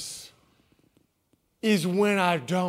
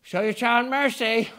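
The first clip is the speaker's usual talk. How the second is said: wife calling out; 3 semitones higher, 4 dB louder than usual. Retold or imitated. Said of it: "called my wife".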